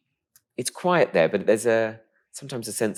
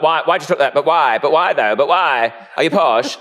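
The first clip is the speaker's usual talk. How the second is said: raised, insistent mock interrogation; nagging questioners; 6 semitones higher, 7 dB louder than usual. People quizzing a boy on his speech, nagging. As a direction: keep prodding, higher and louder. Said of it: "people would be like".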